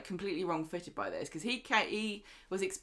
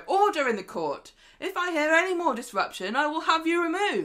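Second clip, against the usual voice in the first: loud, rising, commanding call to order; judge calling order; 10 semitones higher, 7 dB louder than usual. Retold or imitated. Host imitating a TV judge silencing court, loud commanding. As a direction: bang the gavel and lay down the law. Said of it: "and go".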